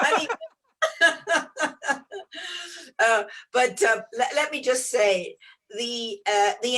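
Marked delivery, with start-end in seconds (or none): 0.8-2.9 long high laugh tailing off; laughter. Real, not acted. Speaker still amused before getting to the point, laughing. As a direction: keep laughing, high and helpless.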